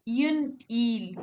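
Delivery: "une île" slowly, with silent s; French pronunciation. Shown as a demonstration. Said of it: "We don't pronounce S".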